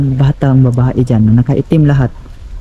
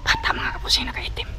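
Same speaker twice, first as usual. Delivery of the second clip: hushed aside; 12 dB quieter than usual. Real, not acted. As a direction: whisper it to the viewers. Whispers aside to viewers about child.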